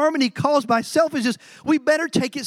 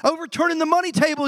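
ordinary talk

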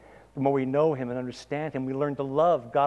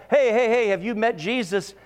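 pushy mock evangelist; 8 semitones higher, 5 dB louder than usual. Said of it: "I don't mean".

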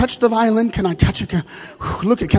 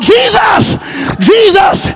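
high, loud cry of a name, twice; calling out; 11 semitones higher, 5 dB louder, much slower than usual. Pretend. Worshipper crying out to Jesus passing by, loud call. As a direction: cry out for him to stop and turn. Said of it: "you never call out".

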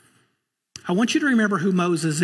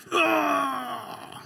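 angry cry; 9 semitones higher, 3 dB quieter than usual. Acted. Moses striking the rock.

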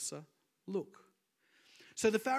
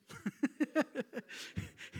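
breathy laugh; 7 semitones higher than usual. Real, not acted. Laughing at cruise ship joke.

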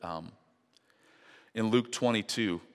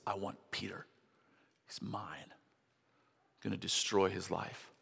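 hushed, menacing Satan, very soft and slow; Satan whispering his claim; sinister whisper; 10 dB quieter, slower than usual. Acted. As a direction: breathe it like the devil plotting. Satan voiced claiming Peter.

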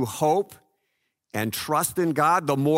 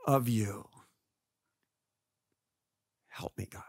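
whispered plea for help; whispered ending to a prayer; 5 semitones lower, 9 dB quieter than usual. Retold or imitated. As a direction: breathe the last words as a whisper. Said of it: "I can go to God and just say".

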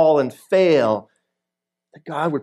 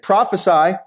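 higher, lightly acted taunt; read-aloud mocking challenge; mocking demand; 5 semitones higher, 3 dB louder than usual. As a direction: jeer at a blindfolded prisoner, mildly. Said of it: "began mocking and beating him".

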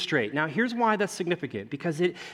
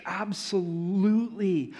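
normal talking voice

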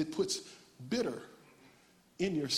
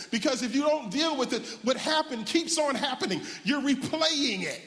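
raised-pitch, urgent preacher exhortation; forceful preaching; 7 semitones higher than usual. Real, not acted. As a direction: drive each point home to the congregation.

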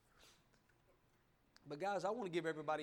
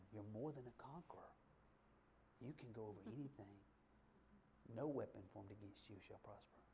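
much softer and lower, hushed; soft whisper; 6 semitones lower, 12 dB quieter than usual. Pretend. Preacher voicing God's quiet words to the congregation, whispering. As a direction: murmur God's promises close and tender. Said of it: "He wants to tell you".

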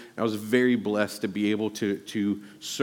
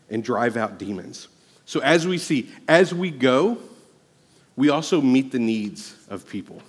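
much higher and louder, preaching at full force; 4 semitones higher, 5 dB louder than usual. Acted. Pastor rallying the church to go out, full-force preaching. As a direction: lift it into a fervent revival shout.

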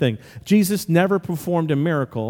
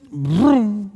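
drawn-out engine-like vroom; imitated zoom; 3 semitones higher than usual. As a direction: make a zooming-past noise. Imitates zooming past the text.

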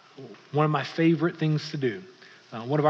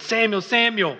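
raised, higher summoning call; 6 semitones higher, 4 dB louder than usual. Retold or imitated. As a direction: call a sleeper by name from afar. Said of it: "the Lord came and called".

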